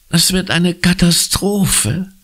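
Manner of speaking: high, slow wail of gloom; whining. A narrator's reading. Conny whines to Anna as they leave. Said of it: "jammert Conny".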